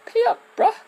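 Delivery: high, cocky taunt; mock trainer voice. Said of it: "then they're like".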